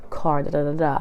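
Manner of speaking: ordinary delivery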